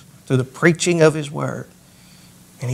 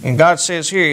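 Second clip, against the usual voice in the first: ordinary delivery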